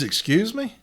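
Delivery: sharp high incredulous "Excuse me?". Retold or imitated. Union rep scoffing at the manager's ruling, incredulous and high. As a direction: challenge the manager in disbelief. AJ confronting the smart-ass manager.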